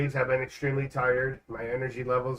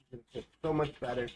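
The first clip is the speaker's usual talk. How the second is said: softer and fast, rattled off; quick soft repeat; 5 dB quieter, much faster than usual. Shown as a demonstration. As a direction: toss it off quickly under the breath. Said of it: "repeats line faster, softer".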